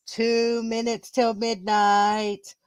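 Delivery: slow, level intercom announcement. Acted. Narrator voicing the countdown over the intercom, slow announcement. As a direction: draw it out on one note. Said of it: "a voice over the intercom says".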